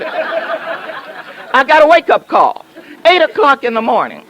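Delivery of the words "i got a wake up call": amused slow restart after an interruption; slow laughing retake. Shown as a demonstration. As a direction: restart the line slowly, chuckling through it. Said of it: "Before I was so rudely interrupted".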